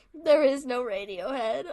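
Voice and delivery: slow words through laughter; laughing. Real, not acted. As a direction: say it while cracking up.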